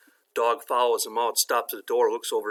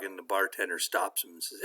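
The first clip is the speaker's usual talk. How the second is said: ordinary delivery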